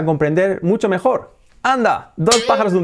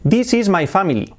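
higher, Spanish-accented English; "family" as "FA-mee-lee", Spanish vowels; clearly separated words in a Spanish accent; learner's Spanish accent; 6 semitones higher than usual. Shown as a demonstration. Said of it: "Todo el mundo te va a entender si dices eso".

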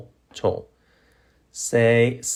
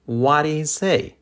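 connected, higher-pitched example question; natural-speed model question; 5 semitones higher than usual. Shown as a demonstration. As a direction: say it as one flowing question. Teacher models sentence at natural speed.

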